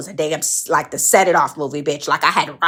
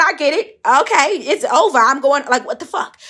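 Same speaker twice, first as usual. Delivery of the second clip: exasperated protest; 9 semitones higher than usual. Retold or imitated. To the cop, high exasperated protest. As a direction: push back in disbelief.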